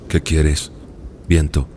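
low grumbling sea voice. Acted. (voz grave del mar)

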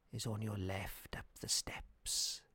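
put-on whispered old man, slow; whispering. A narrator's reading. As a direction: whisper the last directions. Old man ends directions.

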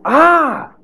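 a high, startled "Ah!" as it dawns; sudden exclamation. Acted. Preacher struck by a thought, high startled cry. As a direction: gasp of discovery, pitched high. Sudden realization mid-sermon.

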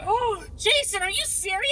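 shocked. Real, not acted. Shocked at hot food.

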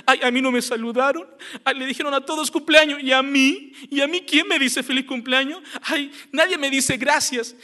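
hammed-up self-pitying grumble; thin-skinned complaining. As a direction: act touchy and left out.

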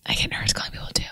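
hushed whisper while on hold. Real, not acted. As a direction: whisper nervously so they can't hear. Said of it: "Why are we whispering?".

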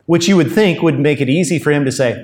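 ordinary talk

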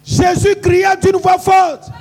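loud, high-pitched rallying shout; full-voiced, high declamation; shouted preacher's call. Real, not acted. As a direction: shout the verse to set off the crowd. Preacher shouts cue to congregation.

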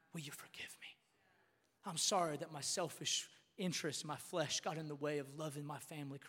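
very soft, low, contrite husband; hushed, tender servant-hearted plea; humble apology. Acted. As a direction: ask forgiveness gently, almost in a whisper. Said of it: "a little bit more of a posture like".

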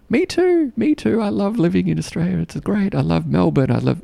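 high-pitched, eager chiming in; gushing enthusiasm. Retold or imitated. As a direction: imitate excited people piling in one after another. Imitating eager café staff chiming in.

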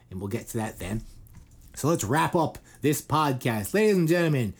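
hammed-up radio host sign-off; podcast outro announcer.

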